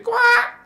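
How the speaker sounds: shrill high bird squawk, loud; parrot squawk. Pretend. Squawking like a parrot at the viewers.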